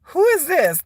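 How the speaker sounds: high-pitched mock bewilderment; imitating puzzled classmates. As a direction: ask like a baffled child. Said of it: "whole class was looking at me like".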